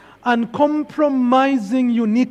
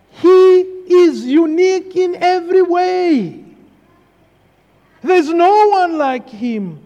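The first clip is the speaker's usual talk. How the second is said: louder, higher pulpit proclamation; fervent preaching; 4 semitones higher, 4 dB louder than usual. Acted. Pastor stressing Jesus' uniqueness, preaching with force. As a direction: drive the point home to the pews.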